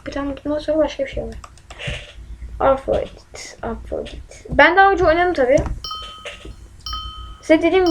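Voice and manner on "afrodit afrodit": whisper. Acted. Whispering a name while playing.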